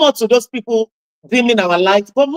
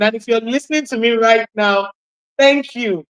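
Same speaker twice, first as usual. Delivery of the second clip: ordinary delivery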